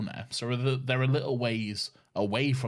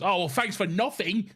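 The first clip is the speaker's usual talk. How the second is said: higher, louder huffy taxi driver; sulky cabbie; 9 semitones higher, 4 dB louder than usual. Retold or imitated. Cab driver brushing off the woman, mock huffy. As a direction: toss it off like a miffed cab driver.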